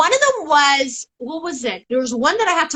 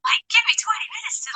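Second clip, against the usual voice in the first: whispered plea; 11 semitones higher, 4 dB quieter than usual. Retold or imitated. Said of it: "I'm like".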